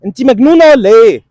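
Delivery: screaming at a pestering woman; furious shouting. Acted. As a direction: blow up at her in rage. Man blows up at the woman.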